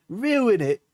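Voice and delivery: high-pitched mock Cockney whine; attempted Cockney. Streamer reading an angry partner's message, mock Cockney. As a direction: put on a high, exaggerated Cockney. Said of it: "It's Cockney, I think".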